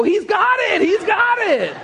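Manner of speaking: very high, exultant cry; hammed-up excited kids cheering; mock triumphant shout. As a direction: crow as if witnessing a miracle. Kids celebrating, acted out.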